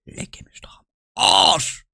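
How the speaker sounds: slow whispered curse; frustrated whisper to himself. Real, not acted. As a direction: mutter it under your breath. Muttering in frustration.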